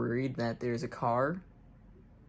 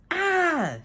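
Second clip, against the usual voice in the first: high-pitched acted "ah" yell; yelled cry; 4 dB louder than usual. A narrator's reading. John running away, yelling out. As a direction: let out a high, startled yell. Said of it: "John yelled".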